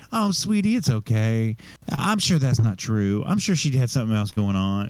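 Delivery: soothing mom. Retold or imitated. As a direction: imitate a mother comforting her child. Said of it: "my mom was like".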